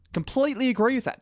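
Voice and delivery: high, overdone mock agreement; exaggerated sarcastic. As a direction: pitch it up in sarcastic over-agreement. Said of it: "that was sarcasm".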